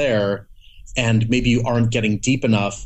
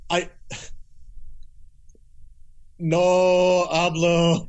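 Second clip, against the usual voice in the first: high, drawn-out "no hablo", then a slow apology; be the lost brain groping for language, slowly; hammed-up bewildered voice, higher and slow; mock confused; 7 semitones higher, much slower than usual. Acting out his brain failing to parse language.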